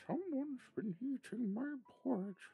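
very high, loud mama bear voice; a fussy high mother bear. Acted. Mama Bear finding her porridge eaten, high voice. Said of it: "said the Mama Bear".

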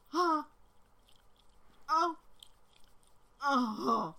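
ordinary talk

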